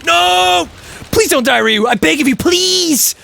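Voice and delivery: high, urgent begging; desperate pleading. Real, not acted. Player begging Ryu not to die, desperate. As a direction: plead in a rising panic.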